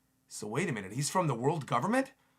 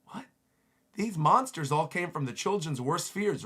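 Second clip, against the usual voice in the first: ordinary talk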